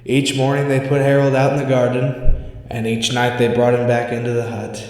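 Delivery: usual speaking voice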